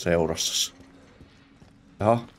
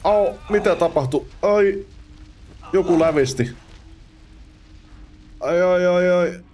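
mock pain; 9 semitones higher than usual. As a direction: cry out as if stabbed. Pained cries after being hit.

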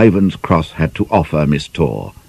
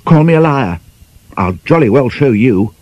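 annoyed; 6 semitones higher than usual. Acted. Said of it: "Kwanyan's turn to become annoyed".

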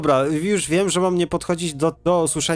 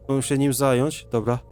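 usual speaking voice

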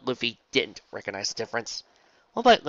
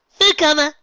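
excited chirp; 13 dB louder than usual. Acted.

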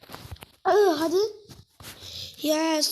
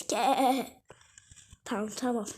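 normal talking voice

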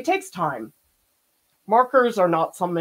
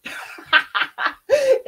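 high giggle; 4 dB louder than usual. Real, not acted. Laughing after fan story.

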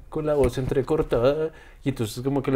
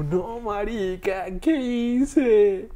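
devastated; 7 semitones higher, much slower than usual. Retold or imitated. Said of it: "Destruido anímicamente".

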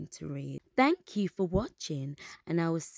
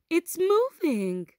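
higher, louder excited shout; excited cry; 10 semitones higher, 6 dB louder than usual. A narrator's reading. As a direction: gasp out the news with delight. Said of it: "Kida cried".

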